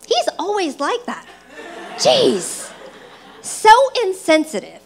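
acted-out exasperated self-justification; indignant inner voice. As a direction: complain to yourself, feeling wronged. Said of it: "having this internal dialogue".

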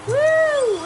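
very high, loud whoop; long excited "woo"; celebratory whoop. Real, not acted. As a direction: whoop with delight. Celebrates in game.

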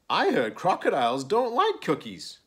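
high, loud storybook squirrel; pointed claim. A narrator's reading. Brown Squirrel tries cutting Crocodile out, pointedly.

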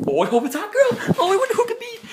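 high, loud laughing excitement; giddy eager squeal; giddy phone rush. Pretend. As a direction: squeal with hope at the ringing phone.

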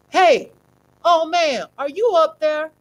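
high, loud call up the stairs. A narrator's reading. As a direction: shout up into the dark house. Thug calling up the stairs.

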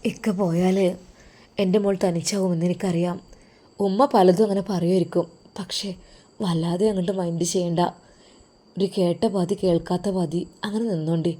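husband quietly comforting his wife before going abroad; tender husband. Acted. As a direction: soothe her gently before he leaves.

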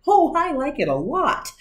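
high cheerful cat; breezy cat, delighted; Cat in the Hat voice. A narrator's reading. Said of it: "said the cat in the hat".

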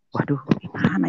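worried whisper. Retold or imitated. Worrying to himself, wet clothes.

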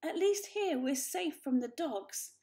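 fearful protest; alarmed. A narrator's reading. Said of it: "cried Mother".

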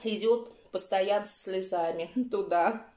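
speaking between bites; chewing while talking. Real, not acted. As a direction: mouth partly full. Seen 1: Eats cereal from bowl; fork with food raised, mouth working between bites.

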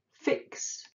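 ordinary delivery

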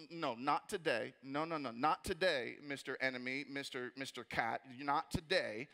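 low, slow, firm refusal; praying mean. Acted. Believer answering the devil when he comes, low and mean. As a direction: shut the enemy down with drawn-out firmness. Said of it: "you can pray mean".

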